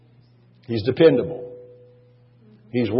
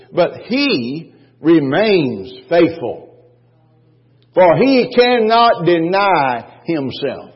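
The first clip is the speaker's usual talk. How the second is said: slow, drawn-out and louder; slow preaching; 3 semitones higher, 3 dB louder, much slower than usual. Acted. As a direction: lean on each word for weight.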